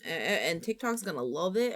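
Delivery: ordinary delivery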